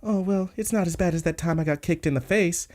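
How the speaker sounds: put-on high, dainty girl voice; little flower girl. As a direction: pitch it up like a shoujo heroine. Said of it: "such a little flower girl".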